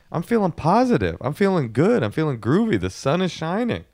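chirpy rise and fall on "positive", "good", "groovy", "shining"; singsong positivity. Acted. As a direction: list them in high, bouncing swoops, "positive" to "shining". Reciting own positivity, singsong.

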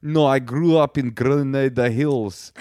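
a KGB agent insisting she's local; cool foreign spy menace. Retold or imitated. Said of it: "she sounds like a K G B agent".

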